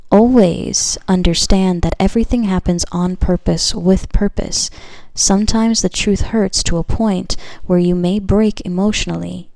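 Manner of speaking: measured, projected reading with weighty pauses; card message read-aloud. Acted. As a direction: read it out slowly and loudly, like a pronouncement.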